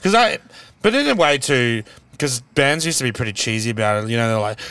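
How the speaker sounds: ordinary talk, nothing marked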